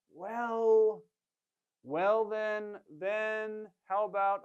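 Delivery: drawn-out, hesitant "well... well then... then"; hesitating. Shown as a demonstration. Waiter pausing to think before suggesting, hesitant. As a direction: stall for time, searching for words. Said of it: "when you're thinking, or you're pausing for a moment".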